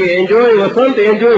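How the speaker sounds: yelling encouragement upward; shouting enjoy. Retold or imitated. Friend calls up to Vasant on the stairs, loud cheering shout. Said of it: "રાડું નહીં".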